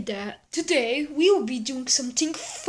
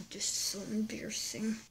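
whispered aside, fast; embarrassed whisper to himself; 5 semitones lower, 12 dB quieter, faster than usual. Acted. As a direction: mutter it under your breath, fast.

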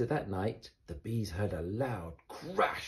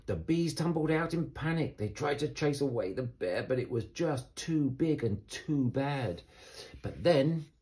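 usual speaking voice

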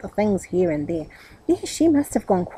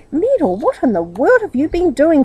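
higher, louder exasperated scold; 7 semitones higher, 5 dB louder than usual. A narrator's reading. Aunt scolding her nephew, exasperated. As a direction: tell him off sharply.

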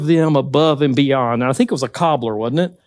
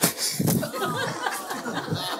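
a burst of high-pitched real laughter; 13 semitones higher, 14 dB quieter than usual. Real, not acted. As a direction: laugh freely at the memory. Laughs at milk story.

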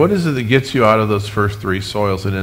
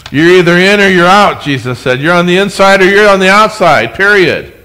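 emphatic quote; 6 semitones higher, 8 dB louder than usual. Acted. Preacher quoting Jesus emphatically.

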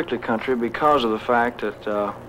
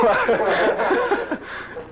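another hearty laugh. Real, not acted. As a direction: laugh it off warmly. Amused by old Sun records.

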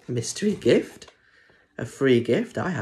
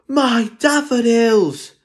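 much higher, slow loud wail; dad cries out; 13 semitones higher, 9 dB louder, slower than usual. Acted. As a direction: howl over the daffodils. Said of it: "said Dad".

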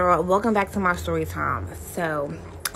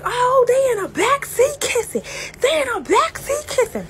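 high, loud mock-alarmed aunt; shrill-pitched tattling holler, imitated; 3 dB louder than usual. Aunt announcing the back-seat kiss to everyone, high mock holler.

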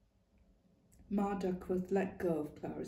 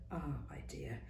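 soft whispered "ah, my dear"; confiding whisper; 4 semitones lower, 9 dB quieter than usual. Acted. Ma confiding in Clara, whispered. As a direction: lean in and whisper it.

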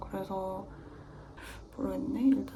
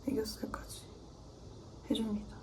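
whisper; 13 dB quieter than usual. Real, not acted. Aside while applying makeup.

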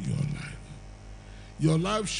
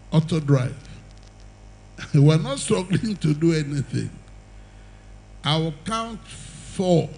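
ordinary talk, nothing marked

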